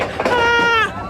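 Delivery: high thrilled scream; roller coaster scream. Real, not acted. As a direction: scream on the drop.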